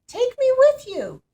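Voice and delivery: very high, loud plea; pleading call. A narrator's reading. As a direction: cry out to be taken along. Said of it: "called the frog".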